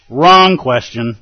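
loud, drawn-out "wrong"; emphatic rebuke. Acted. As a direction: cut the question down like a sword stroke. Zen master's cutting rebuke, acted.

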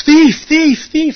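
high, loud retold yell of alarm; yelling thief. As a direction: shout it out across the meal line. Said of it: "he just yells".

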